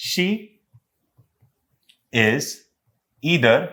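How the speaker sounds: spaced out with long pauses; word-by-word reading. Shown as a demonstration. Teacher unveiling an example sentence to the class, word by word. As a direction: read it one word at a time.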